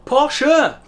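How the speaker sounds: high, loud, emphatic correction; Joey insisting. Retold or imitated. Teacher imitating a sitcom character boasting, insistent. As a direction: insist loudly, pitch jumping up. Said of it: "constantly insisting".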